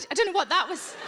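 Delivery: normal talking voice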